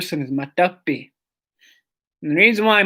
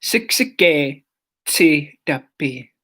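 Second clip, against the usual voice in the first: slow syllable by syllable; slower than usual. Shown as a demonstration. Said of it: "but Siksikaitsitapi".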